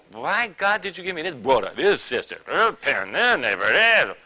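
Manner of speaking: hammed-up wailing gripe, rising to loud; mock exasperated complaint. Believer complains to God about brother and sister, mock exasperation. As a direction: act out griping about everyone around you.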